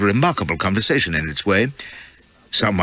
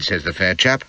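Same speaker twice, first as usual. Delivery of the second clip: ordinary delivery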